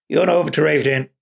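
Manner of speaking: ordinary delivery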